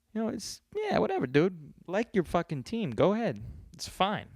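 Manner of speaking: high-pitched, exasperated dismissal; dismissive concession. Acted. Mock-conceding to rival fan.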